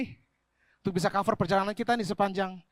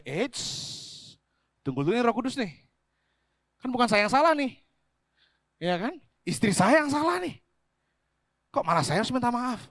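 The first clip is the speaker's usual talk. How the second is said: louder and higher, offended objection; preacher imitating his own stubborn protest to the Holy Spirit; mock indignant protest; 6 semitones higher, 4 dB louder than usual. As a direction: push back like you're sure you're right. Retells arguing with Holy Spirit.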